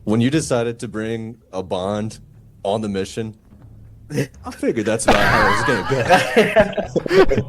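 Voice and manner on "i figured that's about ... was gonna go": high, slow words breaking into a laugh. Real, not acted. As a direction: laugh your way through the admission. Player joking about lost Bond.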